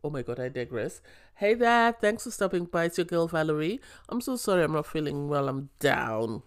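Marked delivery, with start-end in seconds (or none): none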